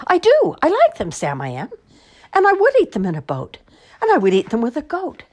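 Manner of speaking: high, loud, fast delight; joyful exclaim. Acted. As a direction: burst out happily. Joyful conversion.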